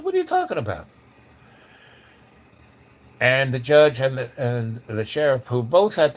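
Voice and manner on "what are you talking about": very high put-on incredulous squeak; baffled. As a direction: imitate bewildered disbelief, pitch way up. Retold baffled reply to joking officials.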